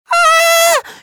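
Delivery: high shrill shriek of fright; fright scream. Acted. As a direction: scream at the spider. Child sees spider, screams.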